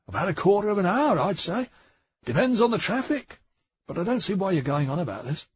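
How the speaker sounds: a shifty junior officer pleading ignorance; flustered constable. A narrator's reading. Bang voiced by narrator.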